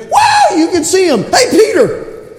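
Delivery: high whooping excited call; sudden high-pitched burst of joy; excited hollering. Acted. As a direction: holler out like an overjoyed man. Acts out healed man's excited shouting.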